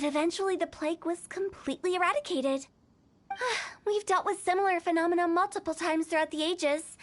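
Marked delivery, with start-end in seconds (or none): 3.3-3.8 long sigh. Real, not acted. Sigh before recounting past duties.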